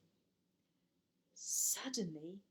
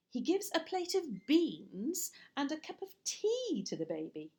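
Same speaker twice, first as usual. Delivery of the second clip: ordinary delivery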